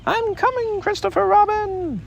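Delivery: very high little squeak; squeaky Roo. A narrator's reading. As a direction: squeak it out like a baby kangaroo. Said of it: "And he squeaked out".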